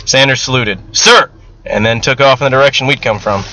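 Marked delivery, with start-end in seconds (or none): 1.0-1.3 loud, high military acknowledgement; 1 sharp sir. Acted. Soldier acknowledging his sergeant's order, sharp. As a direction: snap off a salute.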